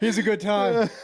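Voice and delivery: high laughing tease. Real, not acted. Host teasing the guest about his social life, laughing high.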